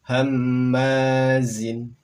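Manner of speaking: "Hammazin" very slow and loud, doubled m held; model Arabic reading. Shown as a demonstration. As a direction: stretch out "Hammazin". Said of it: "Berikutnya silakan". ("Hammazin" dibaca sangat pelan dan lantang)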